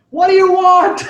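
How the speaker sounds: high, loud demanding question; exasperated parent. Retold or imitated. Parent to teenage child, loud high demand. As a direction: fire it at the kid like a fed-up parent. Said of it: "a parent might ask their teenage child".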